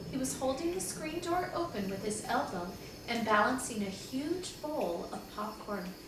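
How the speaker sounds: usual speaking voice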